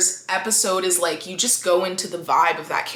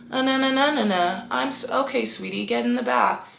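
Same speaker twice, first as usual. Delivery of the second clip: deadpan; 5 semitones higher than usual. Retold or imitated. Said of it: "deadpan and she's like".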